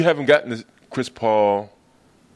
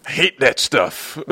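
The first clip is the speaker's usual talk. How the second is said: Kenyon Martin. Retold or imitated. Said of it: "That's what Kenyon Martin sounds like".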